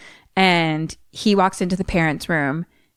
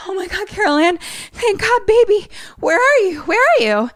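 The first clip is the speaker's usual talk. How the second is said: high, loud, desperate mother; frantic relief; 4 dB louder than usual. Retold or imitated. Mother hears her lost daughter and cries out, high and loud. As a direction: cry out in relief and panic.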